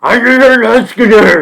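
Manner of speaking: high slow yelling; loud drawn-out shout. Real, not acted. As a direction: bellow it out, stretched.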